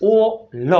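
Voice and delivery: ઓ and લ as two separate sounds; sounded out. Shown as a demonstration. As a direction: split ઓ લ with a clear gap. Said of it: "એનો ઉચ્ચાર થાય છે ઓ". (ઓ લ છૂટું પાડીને)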